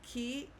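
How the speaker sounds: "Qui" alone, said kee; isolated "Qui", like English key; single syllable. Shown as a demonstration. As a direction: say "Qui" as a separate syllable, U silent. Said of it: "just break it into syllables".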